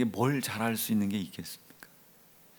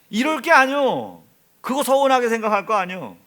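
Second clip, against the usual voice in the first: emphatic preaching; 11 semitones higher, 10 dB louder than usual. Acted. Preacher exhorting the congregation.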